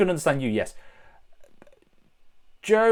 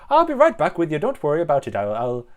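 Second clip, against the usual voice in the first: put-on light Irish accent, reassuring moose; cheery moose slipping into an Irish accent, quick at the end. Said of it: "I don't know why I'm doing an Irish".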